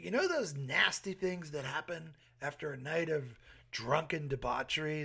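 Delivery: ordinary talk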